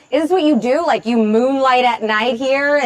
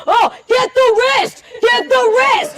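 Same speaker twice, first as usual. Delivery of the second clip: high-pitched imitation of a bar shouter; mock shouting; 8 semitones higher, slower than usual. Comic mimicking a guy at bar UFC fights, mock shouting. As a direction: call it out like a heckling fight fan. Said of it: "you shout out stuff to the room".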